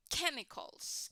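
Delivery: "Chemicals" said slowly and clearly; model word. Shown as a demonstration. Teacher models a word for Thompson to repeat. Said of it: "Can you repeat it".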